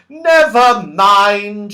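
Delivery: slow, high, loud shout; laughing shout. A narrator's reading. Franny giving up on the deaf man, slow loud shout. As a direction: call it out laughing, drawn out. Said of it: "she shouted".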